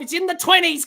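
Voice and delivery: ordinary talk, nothing marked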